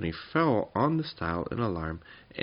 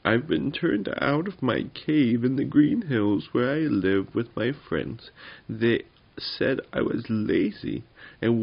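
high, loud, drawn-out complaint; slow, high wail of injustice; aggrieved little goblin; 5 semitones higher, 6 dB louder, slower than usual. Acted. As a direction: pour out your woes as a small goblin. Little goblin tells its woes.

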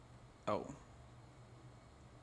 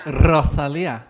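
Spanish accent; 8 dB louder, much slower than usual. Shown as a demonstration. Correcting her friend in a louder Spanish accent. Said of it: "I like to, like, do that accent".

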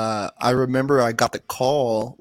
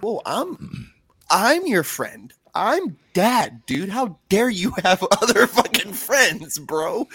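higher, drawn-out playful indignation breaking into a laugh; mock jealous outrage; 7 semitones higher, slower than usual. Dad to his kid about other friends, mock jealous outrage. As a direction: act hammed-up hurt and offended.

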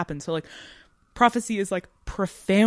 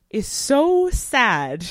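slow, higher and louder, stretched out; heartfelt lament; 8 semitones higher, 7 dB louder, much slower than usual. Real, not acted. Podcaster lamenting the ending, slow heartfelt cry. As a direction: drag it out, moved by the sadness.

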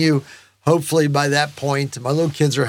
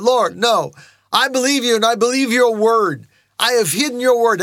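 earnest, higher-pitched declaration to God; fervent insistence, pitch raised; pleading; 6 semitones higher than usual. Acted. Believer assures the Lord of faith, earnest pleading. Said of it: "No, I'm pleading".

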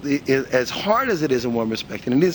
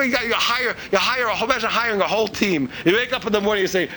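normal talking voice

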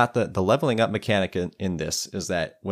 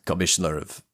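lightly put-on Austrian-Russian accent; Arnold Schwarzenegger. Dwarf loses his thread mid-boast, light Austrian accent. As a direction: let the accented bluster fizzle out, a hint of Austrian. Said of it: "I kinda just did an Arnold Schwarzenegger".

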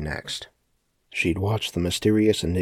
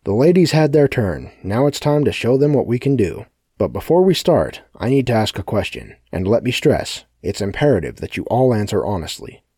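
loud, higher commanding speech; loud announcement; 4 semitones higher, 7 dB louder than usual. A narrator's reading. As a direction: address the crowd loudly. Sebastian addressing frightened crowd.

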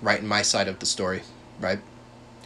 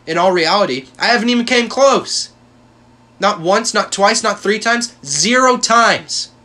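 shouted denial; 9 semitones higher, 7 dB louder than usual. Real, not acted. Insisting he never did it.

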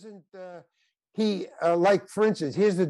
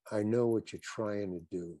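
softer, hushed, leaning in close; mock whisper; 6 semitones lower, 9 dB quieter than usual. Larry David leans in and murmurs to the actor, softly. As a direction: director whispering privately to actor, hushed and soft. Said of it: "he whispers to me".

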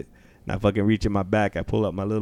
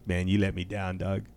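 normal talking voice

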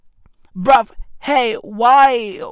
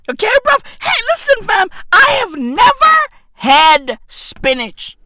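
mock outrage; 9 semitones higher than usual. Outraged spinach revelation.

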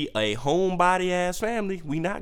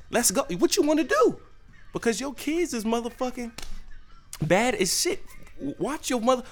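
mock squaring up; 9 semitones higher than usual. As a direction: step up and dare her, pitched high. Squaring up with the mom.

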